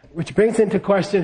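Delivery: usual speaking voice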